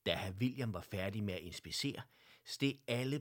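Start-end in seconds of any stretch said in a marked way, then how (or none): none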